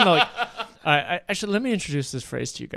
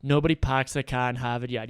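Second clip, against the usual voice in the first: Boston accent with dropped Rs; low-key Boston accent demo; 3 semitones lower than usual. Shown as a demonstration. Host explains the local accent, Boston accent. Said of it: "a word on the Boston accent".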